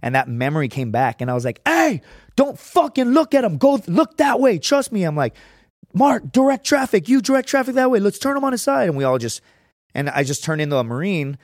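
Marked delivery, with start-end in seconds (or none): 1.7-5.0 urgent command. Retold or imitated. Warning friends off a body. 5.9-8.9 commanding orders. Retold or imitated. Taking charge at accident.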